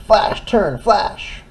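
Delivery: high, bright flashing call; excited. Acted. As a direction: announce it like a beacon. Bridge light flashes.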